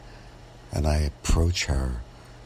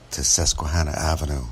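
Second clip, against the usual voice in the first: ordinary delivery, nothing marked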